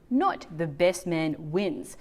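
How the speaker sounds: normal talking voice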